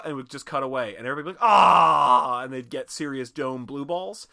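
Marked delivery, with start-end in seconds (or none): 1.5-2.4 loud, drawn-out mock cry of frustration; frustrated group groan. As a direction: yell out like a thwarted drinker. Viewers' frustration at the cutaway.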